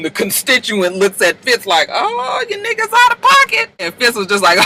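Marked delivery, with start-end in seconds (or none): 1.9-3.7 mock scandalized. Constituent looks at Fitz, high mock shock. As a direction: pitch it way up, aghast.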